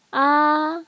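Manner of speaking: "ah" held high and level; a long, steady high "ah". Shown as a demonstration. Teacher likening first tone to doctor's "ah", high and level. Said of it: "first tone is high-level tone".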